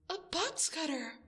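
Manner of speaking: sharp gasp then a bright discovery; gasp, you found it; excited gasp. Acted. Finds a tool to escape.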